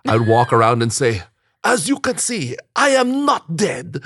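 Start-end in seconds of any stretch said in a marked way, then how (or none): 1.6-4.0 put-on higher, slow Nigerian accent.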